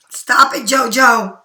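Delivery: sudden loud scold; calling out sharply. Real, not acted. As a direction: break off and loudly tell them to quit it. Breaks off to scold JoJo.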